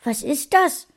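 put-on the little son asks his father, childlike and curious; curious little boy. A narrator's reading. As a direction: lift up into a curious kid's voice.

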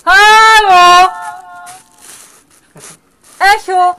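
calling out loud and high; cave echo. Real, not acted. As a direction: shout into the cave to hear the echo.

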